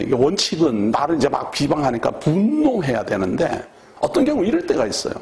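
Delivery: ordinary talk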